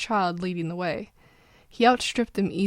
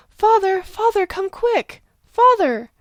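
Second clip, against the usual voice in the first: high, loud desperate cry for father; frightened child shrieking; panicked child screaming; 11 semitones higher, 8 dB louder, slower than usual. Acted. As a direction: scream for help in terror.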